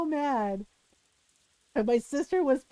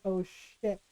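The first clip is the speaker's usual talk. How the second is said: low, much softer muttered dread; hushed dread; 7 semitones lower, 9 dB quieter than usual. Retold or imitated. Daughter hearing mom's door open, low hushed dread. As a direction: mutter it under your breath.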